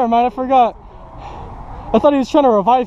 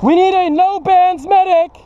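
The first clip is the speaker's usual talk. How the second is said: higher pleading call; man down shout; 4 semitones higher than usual. Real, not acted. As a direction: call out to anyone for help. Calling for a medic.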